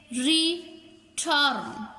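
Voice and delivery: रिटर्न as two slow beats, री, then टर्न; teacher sounding out री—टर्न; split into syllables. Shown as a demonstration. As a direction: say रिटर्न with a clear gap between its two syllables. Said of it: "टू vowel sound देते हों".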